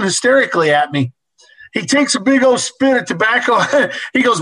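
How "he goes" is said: usual speaking voice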